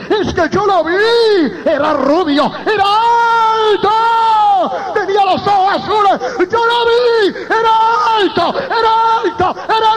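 high, stretched-out acted cry; impassioned insistence. Doctor tells colleague he truly saw him, impassioned cry. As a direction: build to a high wail of insistence.

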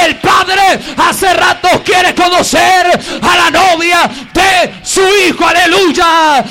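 shouted, slow, ringing preacher cry; full-force preaching. Real, not acted. Pastor crying out the revelation to his church, full-force preaching. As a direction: proclaim it to the whole church.

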